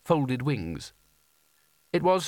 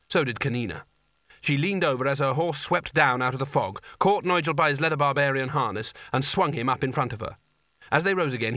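ordinary delivery, nothing marked

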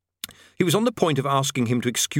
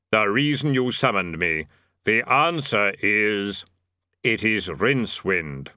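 Death voice; 2 semitones lower, slower than usual. A narrator's reading. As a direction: intone it slowly as Death. Death speaks.

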